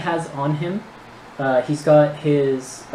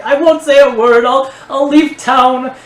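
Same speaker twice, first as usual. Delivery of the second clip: loud, high-pitched panicked pleading; terrified groveling; 11 semitones higher, 9 dB louder than usual. Acted. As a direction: beg for your life, voice high and loud. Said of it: "he's, like, groveling at your feet".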